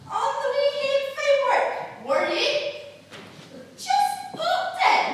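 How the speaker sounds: loud, high broad Scottish wife scolding; furious Kate O'Shanter. Acted. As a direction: tear into the drunk husband in broad Scots. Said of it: "Just as I imagined Kate O'Shanter did".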